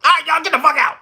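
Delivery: high, loud, laughing mock bark; mock angry dismissal. Mayor ending the meeting, laughing mock shout. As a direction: throw everyone out through laughter. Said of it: "he cut the meeting".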